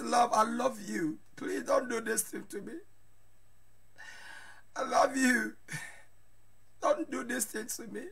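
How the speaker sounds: soft, a touch of crying in the voice; mock tearful plea. Husband retells begging his wife, softly tearful. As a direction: plead softly as a hurt husband. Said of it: "I cry like a baby".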